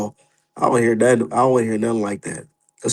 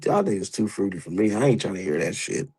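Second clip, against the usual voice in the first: ordinary delivery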